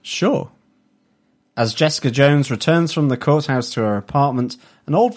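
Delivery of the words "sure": mock sure. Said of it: "You can change up your sure".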